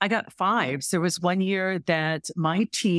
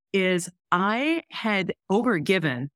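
ordinary talk

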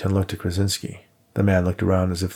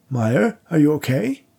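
slow, high worried question; concerned; 6 semitones higher, 3 dB louder than usual. A narrator's reading. Widower asks the collapsing psychic, high and worried. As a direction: ask anxiously, leaning in. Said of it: "Worthington asked in concern".

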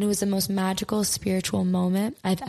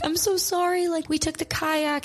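much higher imitated friend apologizing; apologetic friend; 9 semitones higher than usual. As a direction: mimic a sorry friend in a high voice. Friend apologizing to crying speaker, retold.

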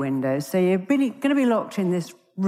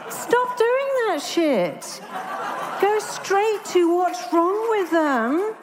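laughing; 11 semitones higher, much slower than usual. Real, not acted. Laughing rant at psychiatrists.

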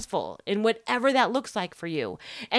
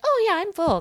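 high, bright fake brush-off, much higher; mock cheery claim; 12 semitones higher, 5 dB louder than usual. Woman pretending to be full at dinner, mock cheery. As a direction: act breezily satisfied.

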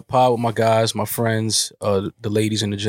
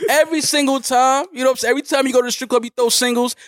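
high, wounded put-on voice; aggrieved mock rant; 13 semitones higher than usual. Complaining about his former partner, high mock-aggrieved rant. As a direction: lament a beautiful thing lost, pitched high.

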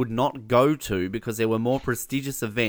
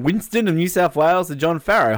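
ordinary delivery

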